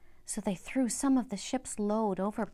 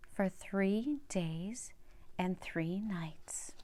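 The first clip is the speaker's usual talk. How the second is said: slow hush; 3 semitones lower, 6 dB quieter, much slower than usual. A narrator's reading. Hushed slow reading of three days.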